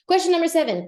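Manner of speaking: loud, high quiz announcement; big game-show announcement; announcing loudly. Real, not acted. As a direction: call out the question number like a game host. Quiz host announcing question.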